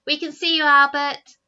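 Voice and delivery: loud, high call up the cliff. Acted. Eldest Snargett calling loudly up to Albert. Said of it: "called the first Snargett".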